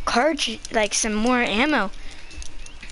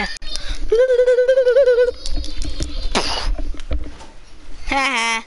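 maniacal laugh; 11 semitones higher than usual. Real, not acted. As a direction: cackle like a proud villain. After stealing a horse.

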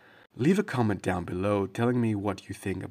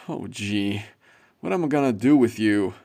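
ordinary delivery

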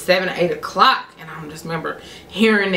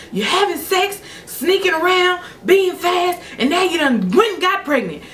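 high-pitched, hammered-out scolding; dad on a tirade, acted out with punch; imitated yelling lecture; 12 semitones higher than usual. Dad scolding pregnant daughter, angry lecturing. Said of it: "He's still yelling".

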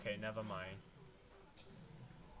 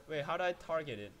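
ordinary talk

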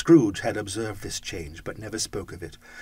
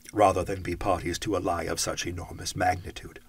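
plain reading voice

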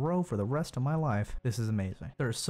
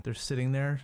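ordinary delivery

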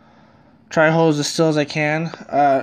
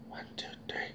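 barely audible whisper; whispered count; 22 dB quieter than usual. Shown as a demonstration. Repeats the mic test whispered.

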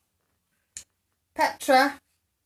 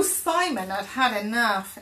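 plain reading voice

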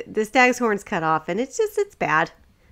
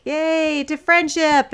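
louder, higher group toast; mock cheer; 6 semitones higher, 4 dB louder, much slower than usual. Friends toast to be buds, mock cheer. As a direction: cheer it like a toast among buddies.

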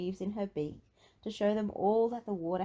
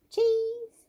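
Peppa voice; 7 dB louder than usual. Acted.

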